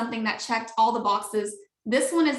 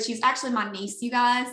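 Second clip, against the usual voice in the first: ordinary talk, nothing marked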